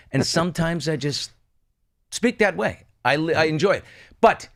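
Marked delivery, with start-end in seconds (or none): none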